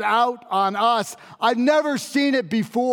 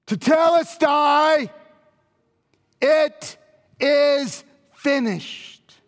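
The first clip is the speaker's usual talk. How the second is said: final cry; 6 semitones higher, slower than usual. Acted. Jesus' final cry on the cross.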